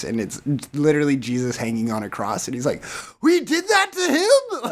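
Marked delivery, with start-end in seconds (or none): 3.2-4.7 much higher, stunned squeaky question through a laugh; shocked disbelief. Retold or imitated. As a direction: play a horrified guy realising his guilt, laughing. Retelling Seth Rogen movie scene.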